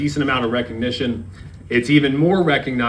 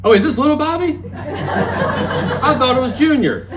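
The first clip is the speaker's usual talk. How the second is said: much higher put-on voice, laughing through it; imitated acquaintance; 4 dB louder than usual. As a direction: squeak it out high while laughing at the memory. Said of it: "and he goes".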